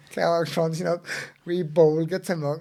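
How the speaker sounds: ordinary delivery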